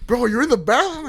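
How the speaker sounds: hammed-up amazed asking; mock disbelief. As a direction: imitate an astonished teammate. Retold teammates' amused disbelief.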